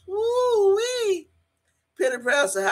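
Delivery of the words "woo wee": high, drawn-out woo-wee; admiring whoop. Real, not acted. Fan swooning over eye candy, high whoop. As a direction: swoop up high and hold it.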